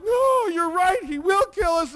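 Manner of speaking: high, loud frightened wail; mock panic. Friend realises the bully will kill them, mock panicked wail. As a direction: imitate a scared kid giving in.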